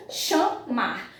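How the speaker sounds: "chamar" split into cha, mar; syllable by syllable. Shown as a demonstration. Teacher showing the verb's two syllables, "chamar" split apart. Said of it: "Como é que é realizada a separação silábica".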